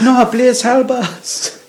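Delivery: high, slow mock plea; high-pitched mock begging; pleading victim. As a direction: beg like a hammed-up victim.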